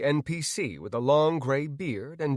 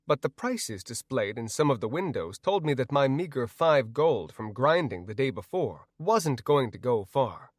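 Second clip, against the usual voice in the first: plain reading voice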